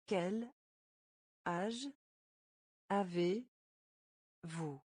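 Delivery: slow word by word. Shown as a demonstration. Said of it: "teacher splits phrase, stretches end".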